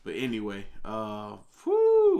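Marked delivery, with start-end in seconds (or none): 1.6-2.2 high, loud drawn-out "whew"; party-starting "whooo"; excited whoop. Real, not acted. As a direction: let out a loud falsetto whoop.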